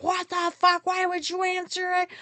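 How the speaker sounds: high-pitched, exasperated mock yell; losing it. Driver to passenger who answered the phone, losing it. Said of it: "I lose my shit".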